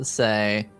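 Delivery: ordinary talk, nothing marked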